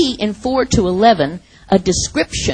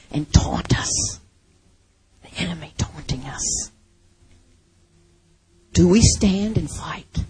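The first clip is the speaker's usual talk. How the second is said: hushed whisper; 2 semitones lower, 4 dB quieter than usual. Real, not acted. Preacher hushes the congregation about the enemy's taunts. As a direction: draw them in with a whisper.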